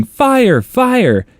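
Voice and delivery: high, falling imitated cry; mock scream. Neighbor screaming for her cat at night, mock scream. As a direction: cry out in alarm, not at full volume. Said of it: "My dad heard a neighbor screaming".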